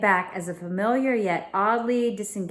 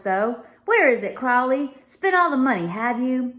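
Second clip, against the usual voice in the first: raised, exclaiming gentleman; higher, louder Victorian gentleman; Mr Gladstone voice; 6 semitones higher, 4 dB louder than usual. Acted. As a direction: voice the startled father of the house.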